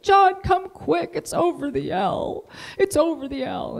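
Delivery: high, slow tearful cry for her husband; wife crying to her husband as the flood news shows on TV; retold crying plea. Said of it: "She's crying at the television set".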